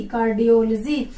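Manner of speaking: कार्डियोलॉजी as car-di-OL-jee; corrected pronunciation. Shown as a demonstration. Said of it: "कार्डियोलॉजी नहीं". (कार्डियोलॉजी को कार्डियोल-जी बोलकर)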